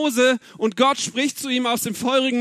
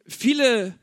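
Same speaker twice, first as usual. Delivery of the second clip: ordinary talk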